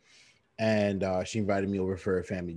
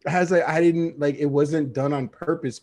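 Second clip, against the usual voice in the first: higher, louder mock protest; 7 semitones higher, 4 dB louder than usual. Telling his friend it wasn't on purpose, mock protest. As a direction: plead your innocence, hammed-up.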